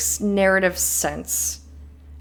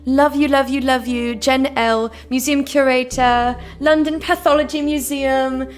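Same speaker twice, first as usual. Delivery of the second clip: hammed-up, wandering British accent; terrible British accent; 2 semitones higher, 3 dB louder than usual. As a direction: put on a badly faked British accent. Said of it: "Please enjoy my terrible British accent".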